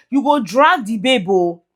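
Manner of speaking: ordinary talk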